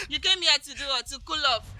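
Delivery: mocking laugh.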